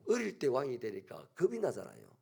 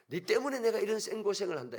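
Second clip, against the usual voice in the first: higher and louder, hurling the blame; angry blame; 7 semitones higher, 5 dB louder than usual. Acted. Adam blaming his wife Eve for his hardship, angry. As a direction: snap at her that it's all her fault.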